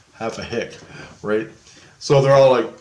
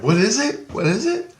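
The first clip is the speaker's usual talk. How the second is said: high, eager "What is it?"; curious high-pitched asking; eager high question; 11 semitones higher than usual. Retold or imitated. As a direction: ask it high and eager, leaning in. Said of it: "and I'm like".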